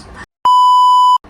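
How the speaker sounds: high, loud squealing laugh; burst of laughter. Real, not acted. Reacting to an absurd review.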